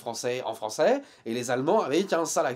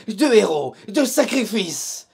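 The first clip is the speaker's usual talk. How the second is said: loud, slowed-down trailer voice; mock epic; 6 semitones higher, 10 dB louder, slower than usual. Vlogger teasing viewers with a mock epic movie-trailer tone. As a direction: oversell it like a war movie trailer.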